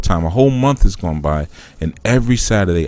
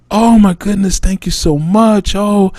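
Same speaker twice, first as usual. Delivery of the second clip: much higher, louder, gushing thanks; overjoyed thanks; 12 semitones higher, 4 dB louder than usual. Pretend. Neighbor thanks the giver for much-needed groceries, high and gushing. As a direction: gush like a neighbor who really needed help.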